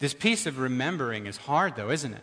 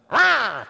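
loud laugh; 8 semitones higher, 7 dB louder than usual. Real, not acted. Laughs at himself.

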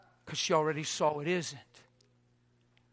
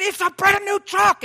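upset insistence; 12 dB louder than usual. Retold or imitated.

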